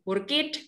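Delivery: "work it", slow, two syllables for worked; wrong ending, work it. Shown as a demonstration. Teacher showing another wrong reading, slow "work it". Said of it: "bukan, tapi pakai".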